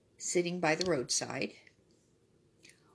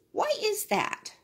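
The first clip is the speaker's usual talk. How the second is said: donkey asking. A narrator's reading. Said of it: "said the donkey".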